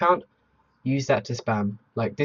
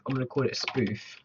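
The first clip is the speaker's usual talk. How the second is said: usual speaking voice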